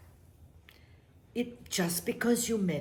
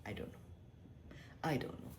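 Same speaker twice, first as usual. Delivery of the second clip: normal talking voice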